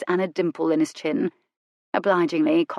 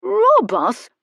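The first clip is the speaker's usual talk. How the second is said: high, loud, hesitant servant voice; confused footman; 13 semitones higher, 6 dB louder than usual. A narrator's reading. Footman fearing a robbery, high and confused. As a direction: trail off in worried confusion. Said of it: "His forehead bunched in confusion".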